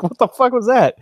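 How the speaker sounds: high, loud mock disbelief; mock baffled reaction. Game master reacting to their own failed accent, mock baffled. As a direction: exclaim it as if baffled by the noise.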